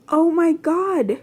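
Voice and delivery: panicked gasping cry; startled panic, slow. A narrator's reading. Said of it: "You mentally panicked".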